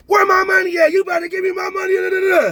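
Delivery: very high, loud mock yell of an angry woman; mock screaming woman. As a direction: shriek it at him for your money. Woman confronting Zaza at table.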